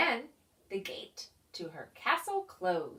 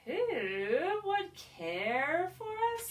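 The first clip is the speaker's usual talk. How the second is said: eager pig voice; 8 semitones higher, 3 dB louder, much slower than usual. Acted.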